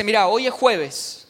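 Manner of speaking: loud, slow and firm; firm loud reply. Retold or imitated. Director telling broke student the deadline, firm and loud. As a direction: lay out the deadline firmly.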